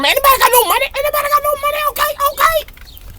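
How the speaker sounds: comic high, far-off voice; joking about emergency-room costs in a squeaky high voice. Pretend. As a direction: squeak it out as a joke.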